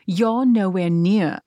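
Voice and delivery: slow, drawn-out mock British accent. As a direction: imitate her with a put-on British accent. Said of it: "I'm not doing the British accent".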